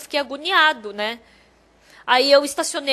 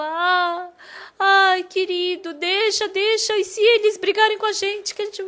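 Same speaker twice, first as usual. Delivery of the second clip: anxious pleading; 5 semitones higher than usual. Retold or imitated. Frightened wife begging her husband to drop the quarrel, pleading. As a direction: plead with him in a high, fretful voice.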